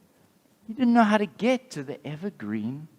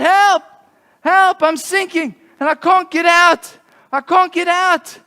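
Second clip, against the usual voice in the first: loud, high mock cry for help; 13 semitones higher, 13 dB louder than usual. Christopher stuck in the swamp, shouting for help loudly. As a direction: yell for help, hammed up for kids. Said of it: "he cried out".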